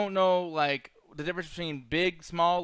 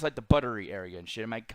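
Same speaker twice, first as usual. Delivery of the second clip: ordinary talk, nothing marked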